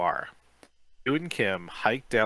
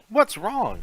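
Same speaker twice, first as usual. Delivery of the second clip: worried question; 11 semitones higher, 5 dB louder than usual. A narrator's reading. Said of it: "she asks".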